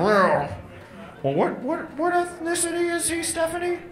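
a prying dad fishing for the truth; suspicious dad. Acted.

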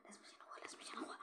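breathy, voiceless pleading; frightened whisper. Real, not acted. Player pleading in a hushed whisper while hiding.

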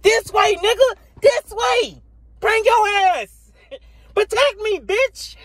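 high, loud bossy shouting; yelling at teammates. Retold or imitated. Gamer yelling orders at online teammates, high and loud. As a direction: order them around in a high yell. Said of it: "You be like".

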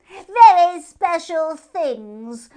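high, very loud and very slow; hare admiring. A narrator's reading. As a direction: savour each word like treasure. Hare character voice.

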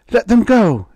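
high, loud woman's demand; alarmed mother's shout; crying out. A narrator's reading. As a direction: cry out to protect the children. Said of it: "she cried".

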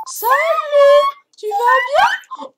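put-on shrill, loud, slow baby voice. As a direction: stretch it out, squealing like a baby. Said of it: "je vais le refaire".

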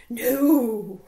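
loud, drawn-out refusal; crowd yell. A narrator's reading. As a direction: answer as one shouting crowd. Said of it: "yelled the crowd".

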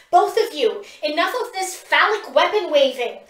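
exasperated scolding. A narrator's reading. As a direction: tear into them both. Pip scolds both fighters.

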